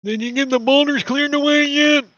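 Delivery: high, loud distant holler; in-character shout back. Acted. Game master answers the player's yell about boulders, high shout.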